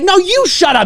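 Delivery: high-pitched indignant retort; hammed-up comeback; mock quarrel. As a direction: throw it back in a staged argument. Acts out escalating quarrel.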